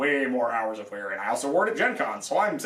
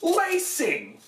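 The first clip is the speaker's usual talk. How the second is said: Lacing! flung out high and sharp; exasperated outburst; 13 semitones higher than usual. Real, not acted. Reviewer repeating the word in disbelief at the maker, exasperated. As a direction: throw up your hands at the word Lacing!. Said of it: "this is really frustrating".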